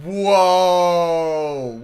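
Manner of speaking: surprised shout. Real, not acted. Sudden surprise at game screen.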